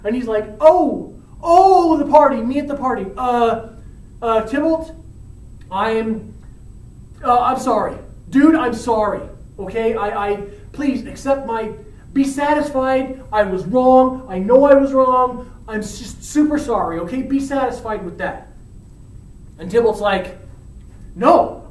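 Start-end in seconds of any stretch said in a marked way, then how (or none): 0.6-18.5 high, stammering appeasement; pleading, halting acted apology; flustered apology. Romeo hastily apologizes to Tybalt. 21.1-21.7 angry refusal. Acted. Tybalt rejects the apology.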